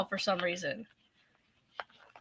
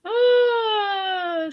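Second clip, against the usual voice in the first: high teary laugh; 9 semitones higher than usual. Real, not acted. Laughing after bad day.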